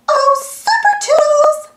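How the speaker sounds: high, sweet Minnie Mouse voice calling out. Acted. Minnie calls Super Toodles for help, high cartoon voice. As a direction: call out high and bright like Minnie. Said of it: "calls Minnie".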